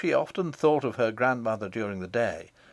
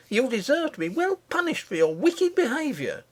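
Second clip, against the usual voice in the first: angry cry; 13 semitones higher than usual. A narrator's reading. Nurse cries out angrily at the misbehaving princess. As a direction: cry it out at the child like a furious nurse. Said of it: "cried her nurse".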